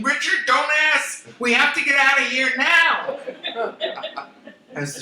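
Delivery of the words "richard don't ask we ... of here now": high, loud panicked urgency; yelling. Retold or imitated. Fleeing wife yells to husband in the car, panicked. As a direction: shout to the husband to drive off.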